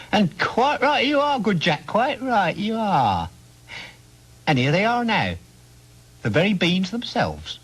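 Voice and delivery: high, slow, sing-song stranger; a crafty old man fawning over Jack; wheedling, high-pitched peddler voice; odd old man. Acted. Strange man praises Jack, offers beans.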